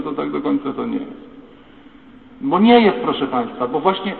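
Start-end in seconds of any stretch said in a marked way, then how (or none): 2.4-3.0 forceful denial. Real, not acted. Preacher rejecting the lie to the congregation, loud forceful denial. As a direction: slam the denial down hard.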